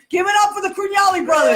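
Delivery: high, fast announcer shout; applause shout. Real, not acted. Host cueing applause for the brothers, excited shout. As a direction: whip up the crowd for the guests.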